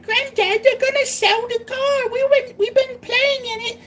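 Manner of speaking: high squeaky kid in mock Cockney; exaggerated high-pitched English kid accent; English child accent. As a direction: pipe up as a little English child. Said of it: "I'm gonna do the accent".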